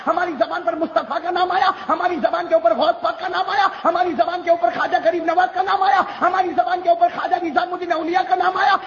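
normal talking voice

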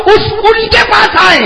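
high slow preacher's shout; fervent sermon shout; preaching at full force. Acted. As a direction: cry it out to the crowd. Preacher climax.

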